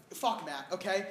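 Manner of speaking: low, soft curse; muttered jab. Acted. Comic cursing absent partner, low and soft. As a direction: mutter it under your breath.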